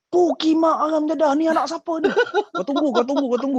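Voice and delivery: high, loud scolding rant; mock angry old man. Great-uncle yells at kids, mock furious and high. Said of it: "dia cakap".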